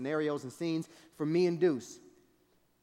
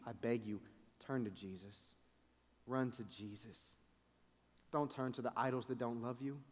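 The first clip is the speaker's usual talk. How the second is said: hushed, sincere appeal, much softer; tender pleading; 2 semitones lower, 7 dB quieter than usual. Acted. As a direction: beg them gently to turn to Jesus.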